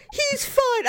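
mock the husband brushing it off; whiny husband. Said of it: "And so he's like".

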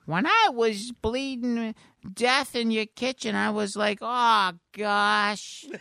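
high, slow cartoon voice; high, heartfelt cartoon creature; Mister Poopy Butthole. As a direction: recount it in a drawn-out, high silly voice. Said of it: "Mister Poopy Butthole sitting on the bed".

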